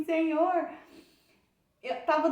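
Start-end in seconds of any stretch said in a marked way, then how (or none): 0.0-0.7 mock tearful cry to God, higher pitched; clamor choroso imitado. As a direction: call out to the Lord as if crying. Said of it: "E aí eu chorando, chorando". (clamor imitando choro)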